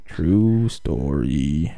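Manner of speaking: very slow, very low mock pronouncement; mock-solemn drawl. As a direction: stretch each word out deep and long. Mock solemn tag to a joke.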